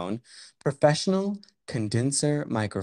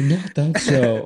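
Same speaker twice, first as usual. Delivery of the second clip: drawn-out protest; 4 semitones higher, 5 dB louder, much slower than usual. Acted.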